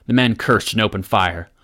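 ordinary delivery, nothing marked

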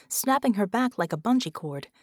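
ordinary delivery, nothing marked

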